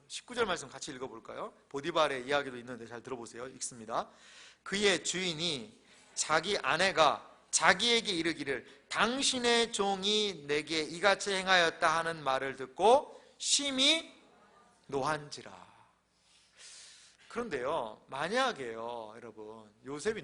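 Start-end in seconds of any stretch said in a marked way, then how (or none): 4.6-15.7 loud, slow, declaimed verse; scripture reading. Acted. Pastor reading Joseph's false accusation to the congregation, loud solemn declamation. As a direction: proclaim each phrase with weight, stretched out.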